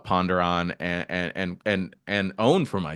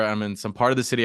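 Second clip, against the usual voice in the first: ordinary talk, nothing marked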